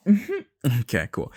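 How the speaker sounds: high sarcastic hum, then dropped cool; sarcastic mm-hmm. Retold or imitated. After a rejection call, mock sarcastic acceptance. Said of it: "And it's like".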